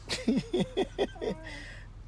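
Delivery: high-pitched giggle; laugh. Real, not acted. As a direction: giggle helplessly at the absurd price. Laughs at dollar not buying ice cream.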